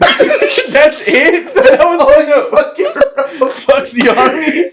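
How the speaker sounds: high laughing disbelief, slowed. Real, not acted. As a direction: laugh through the words. Cracking up at letter.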